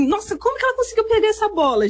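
high, quick burst of disbelief; amazed. Retold or imitated. Fan watching Fabi's unbelievable saves, amazed. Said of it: "coisas inacreditáveis".

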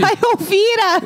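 high, slow delighted laugh-shout; laughing exclamation. Real, not acted. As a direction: burst out laughing with the name. Laughing recognition of Elvira.

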